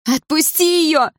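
high, loud, slow desperate scream. A narrator's reading. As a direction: scream at him to let go. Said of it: "закричала я".

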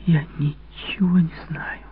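high, slow, stammered whisper; faltering whisper. Acted. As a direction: barely get the words out. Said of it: "пролепетала она".

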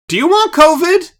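high, loud mocking scold. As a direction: scold like a disgusted bystander.

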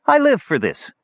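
high-pitched showman's delight; satisfied. A narrator's reading. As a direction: savour it, voice lifted high. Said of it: "with a satisfied smile".

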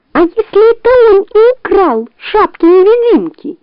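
high, anxious fox voice; worried fox. A narrator's reading. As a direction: fret up high.

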